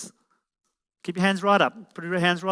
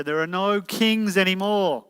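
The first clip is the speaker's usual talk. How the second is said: normal talking voice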